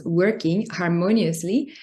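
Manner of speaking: ordinary talk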